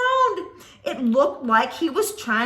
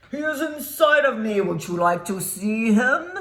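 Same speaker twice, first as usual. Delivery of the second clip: monster voice; 5 semitones lower, 3 dB louder than usual. Acted.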